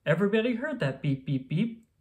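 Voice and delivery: ordinary delivery, nothing marked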